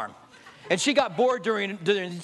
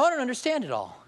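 normal talking voice

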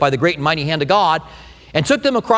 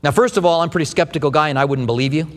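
normal talking voice

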